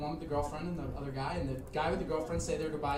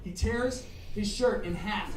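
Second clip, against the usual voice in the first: ordinary talk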